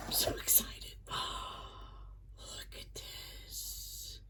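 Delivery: breathless whisper of delight; hushed thrilled whisper; excited whisper. Real, not acted. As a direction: whisper it like you can't contain yourself. Excited over the box contents.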